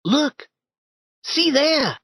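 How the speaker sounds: put-on high boyish voice, urgent; a startled boy pointing out a noise; young Tom, alarmed. Tom nudging Huck about a noise, high alarmed boy.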